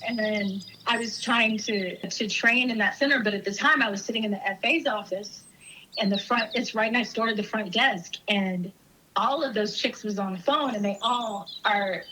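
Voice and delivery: ordinary delivery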